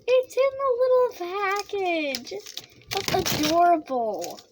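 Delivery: high, slow delighted coo; cooing over cute. Real, not acted. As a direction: coo at it like something adorable. Cooing over cute snack package.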